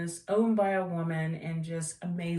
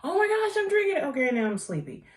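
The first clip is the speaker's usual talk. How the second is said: much higher and louder, hammed-up caffeine rush that flips to drowsy; mock coffee jolt; 10 semitones higher, 6 dB louder, faster than usual. Telling viewers what coffee does, mock excited jolt. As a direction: play the jitter of coffee, then slump. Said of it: "It's like".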